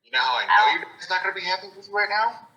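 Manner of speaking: exasperated scolding. Acted. Party member scolds a companion over his dad, exasperated. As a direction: scold them loudly, climbing to the question.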